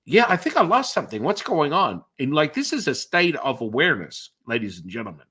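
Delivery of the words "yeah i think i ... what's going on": high, quick, puzzled friend voice; confused friend. Retold or imitated. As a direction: play the lost, bewildered friend. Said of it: "they're looking at me going".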